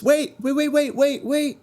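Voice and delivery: little frog squeaking to be heard; small voice. Acted. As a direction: pipe up small and high to cut through the crowd. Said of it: "you hear a small voice".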